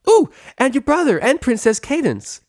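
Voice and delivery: very high, loud, bouncy; excited. A narrator's reading. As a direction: burst out with excitement. Pinkie jumps up excitedly.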